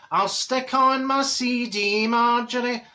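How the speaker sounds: high, very slow friend impression; slow-talking Dorothy. Friend offers Marjorie some party music, high slow imitation. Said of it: "And Dorothy says".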